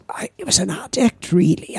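usual speaking voice

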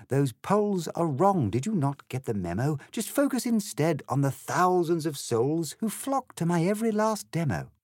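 high-pitched reciting of a politician's rebuttal; self-assured party leader. Acted. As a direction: brush off the doubts in lilting verse.

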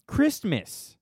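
Christmas drawn out very slowly; slow, deliberate read of Christmas; reading the topic. Shown as a demonstration. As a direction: stretch out Christmas as you read the fan's question. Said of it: "you spelled it wrong".

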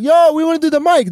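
high, eager voice asking to get on; eager mock plea. Newcomer asking comics outside for a spot, high and eager. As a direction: pitch it way up, keen newcomer.

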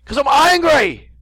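hammed-up, high, drawn-out angry shout; mock angry yell. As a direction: bellow it in comic rage. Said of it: "because we're angry".

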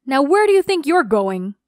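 loud, menacing demand to stop her; stern challenge. Acted. Fake dad catching the girl at the back door, loud and menacing.

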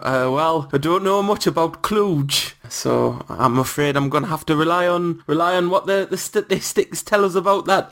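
put-on Shearer in Newcastle accent. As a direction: put on a terrible Geordie accent. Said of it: "Newcastle accent, terrible".

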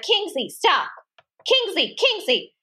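high sharp call to the dog, stopping him at the door. Retold or imitated. Kim calling her dog back at the door, high and urgent. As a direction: call the dog's name up high, firm.

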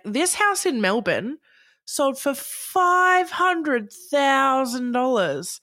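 high, drawn-out mock price reveal; TV presenter mimicry. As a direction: mimic a property show host, stretching out the big figure. Mimics TV property show's big price reveal.

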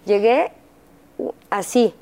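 ordinary delivery

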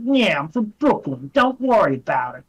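a light Brooklyn tough-guy voice; mild Brooklyn accent impression. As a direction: imitate a cartoon Brooklyn kid. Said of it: "they gave him a Brooklyn accent".

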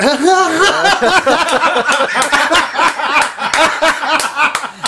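long high-pitched burst of laughter; real laughing fit. Real, not acted. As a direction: crack up helplessly at your own joke. Cracks up at own story.

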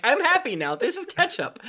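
silly character voice. Pretend.

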